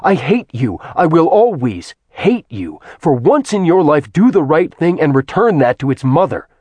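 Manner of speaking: enraged man cursing the goddess; furious tirade. Acted. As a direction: spit hatred at her, full force. Acheron curses Artemis in rage.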